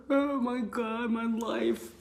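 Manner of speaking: high, wailing lament, voice cracking; imitating people crying in meditation; mock crying. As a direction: sob it out like someone overwhelmed. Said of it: "and then they start crying".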